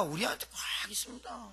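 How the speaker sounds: hushed whisper of a secret. Acted. Preacher confiding to the congregation in a whisper. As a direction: lean in and whisper it.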